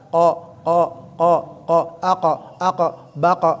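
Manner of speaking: repeated "kok" with a sharp bouncing qaf; qalqalah drill. Shown as a demonstration. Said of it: "dilatih gitu lah". (latihan pantulan kok berulang)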